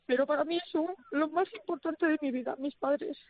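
really moved, declaring love for family; choked up, pausing to keep going; overcome with emotion. Real, not acted. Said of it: "perdóname que me haya emocionado".

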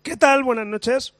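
loud, high greeting through a congested voice. Real, not acted. Miguelito greets the radio hosts, congested and loud. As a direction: greet loudly with your voice affected by congestion. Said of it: "Uy, qué voz tiene".

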